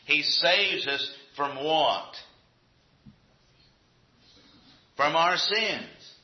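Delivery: slow emphatic question. Real, not acted. Teacher drives home key point.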